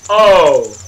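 sudden loud startled cry; startled yelp. Real, not acted. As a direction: jump and yelp in shock. Startled by game moment.

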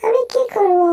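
high-pitched character lament; moping cartoon character. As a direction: fret aloud in a high cartoon voice. Cartoon figure moping aloud.

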